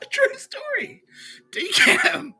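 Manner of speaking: laughing. Real, not acted. Can't stop laughing.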